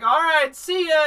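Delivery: high, loud carefree goodbye; mock cheerful parents; breezy parents. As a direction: toss off a light see ya. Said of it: "they're like".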